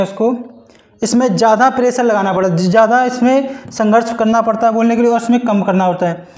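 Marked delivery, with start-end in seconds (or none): none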